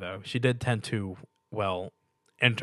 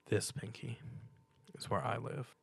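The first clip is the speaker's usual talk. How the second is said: much softer, sad hush; softly; 12 dB quieter than usual. A narrator's reading. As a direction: say it softly with a tear. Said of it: "he said softly".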